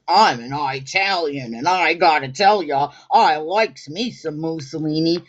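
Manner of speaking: a hint of a tough-guy Italian American accent; mock Italian American. Host imitating a man at the stream, light Italian American accent. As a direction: lightly ham up a Mussolini fan. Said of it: "He was like".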